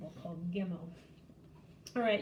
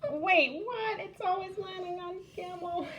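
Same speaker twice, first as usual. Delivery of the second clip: high, loud disbelief breaking into a whine; wait, what, not again; surprised; 9 semitones higher, 3 dB louder than usual. Real, not acted. Teacher to viewers as the dreidel keeps landing, surprised.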